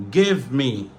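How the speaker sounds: "Give me" with the v sounded; normal full pronunciation. Shown as a demonstration. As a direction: articulate both words of "Give me". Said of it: "ça c'est prononciation normale".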